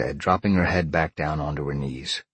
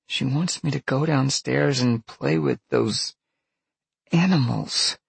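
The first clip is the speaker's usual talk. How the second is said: put-on high-pitched appalled girl; gasping, appalled girl; 10 semitones higher than usual. A narrator's reading. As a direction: say it high, horrified at the idea. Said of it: "Peg gasped".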